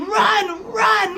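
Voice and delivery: high-pitched retold crowd scream; imitated screaming. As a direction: cry it out high like a panicked crowd. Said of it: "then they were screaming".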